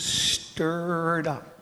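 "stirred" stretched out slowly; long, drawn "stirred" in "stirred up"; drawn-out emphasis. Shown as a demonstration. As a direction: linger on "stirred" before "up". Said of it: "beautiful little word there in the Greek".

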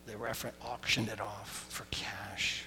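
hushed confidential whisper. Real, not acted. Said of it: "whispered line".

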